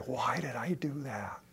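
breathy regretful whisper to oneself. Retold or imitated. A person looking back on a bad choice, whispering.

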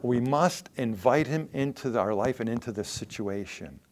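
ordinary talk

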